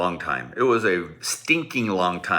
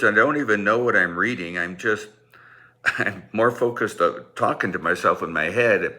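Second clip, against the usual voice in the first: usual speaking voice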